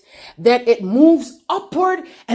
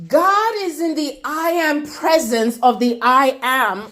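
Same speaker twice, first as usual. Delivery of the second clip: ordinary delivery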